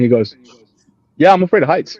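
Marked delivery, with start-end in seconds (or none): 1.2-2.0 imitating friend's admission. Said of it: "just the way he did it was funny".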